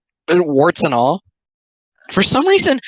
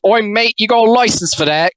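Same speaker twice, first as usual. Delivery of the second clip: hammed-up British "oi, mate", pitched higher; higher-pitched caricature British voice; mock British accent; 6 semitones higher than usual. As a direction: put on a cartoonish British accent, rising into the question. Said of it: "So we got British person goes".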